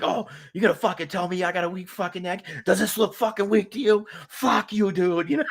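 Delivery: Boston accent, mock offended. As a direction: tough Boston rant, voice up high. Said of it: "he's from, like, fucking Boston".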